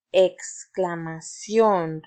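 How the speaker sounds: exclamación drawn out slowly, each syllable clear; slow model word. Shown as a demonstration. Teacher introduces exclamación to the class, slow and clear. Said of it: "repite conmigo".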